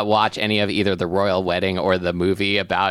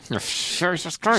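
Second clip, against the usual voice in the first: gritted teeth; 9 semitones higher, 3 dB quieter than usual. Retold or imitated. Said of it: "Let's do the entire rest of the show like this".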